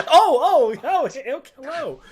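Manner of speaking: real laughing oh-oh hello; laughing surprise. Real, not acted. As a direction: laugh out a startled greeting.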